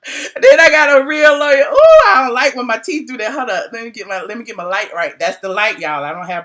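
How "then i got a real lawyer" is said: loud, laughing repeat of a dig; laughing jab. Real, not acted. Blogger teasing about her old lawyer, laughing and loud. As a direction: crow it through your laughter.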